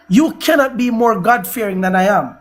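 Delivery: usual speaking voice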